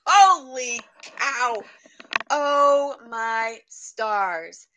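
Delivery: loud, very slow, drawn-out awe; retold amazement. Reacting to the passage friend read, amazed and drawn-out. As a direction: stretch each word out, floored.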